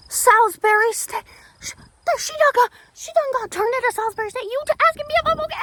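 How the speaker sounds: very high, frantic squeal of disbelief; high-pitched outburst. Retold or imitated. Student retells her outburst at people asking about her, high squeal. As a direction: splutter it out high and loud. Retelling upset at being asked.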